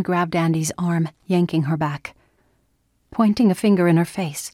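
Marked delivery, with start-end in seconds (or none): none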